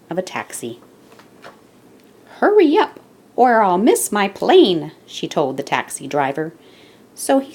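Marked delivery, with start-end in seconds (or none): 2.4-4.9 impatient woman. Acted. Woman urging taxi driver.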